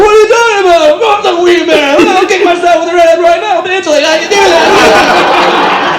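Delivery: high, loud frantic denial; mock panic. Comedian insisting he's not Wee Man, high loud frantic voice. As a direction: yelp it like a panicked impostor. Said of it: "And I'm like".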